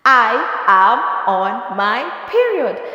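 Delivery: slow, proud, firm declaration; said with your chest. Shown as a demonstration. Telling people she has her period, slow proud declaration. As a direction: own every word, slowly and proudly. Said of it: "Say it with your chest".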